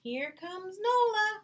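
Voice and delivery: put-on high, loud and slow child calling out; stretched high loud greeting; calling friend. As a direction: call across the street like an excited little girl. Friend calls out from street.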